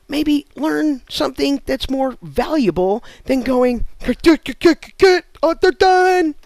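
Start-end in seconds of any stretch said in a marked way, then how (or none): 4.0-6.4 high, loud, rapid mock chant; mindless burger flipper. Comic mocks a burger flipper chanting at the grill. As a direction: bark it like a dumb robot at the grill.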